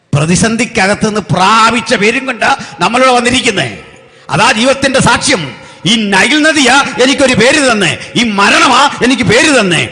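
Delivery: high, loud, relentless proclamation; full-force preaching. Real, not acted. Preacher testifies to a name won in crisis, full-force preaching. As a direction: build to a fervent pulpit climax and hold it.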